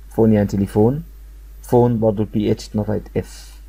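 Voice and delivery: ordinary talk, nothing marked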